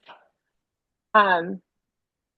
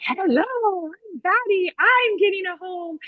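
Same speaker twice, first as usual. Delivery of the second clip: high, squeaky little bat; bat puppet voice; 8 semitones higher than usual. Retold or imitated. Teacher as bat puppet greeting the class, high cartoon voice. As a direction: pipe up like an excited puppet bat. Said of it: "like a different voice".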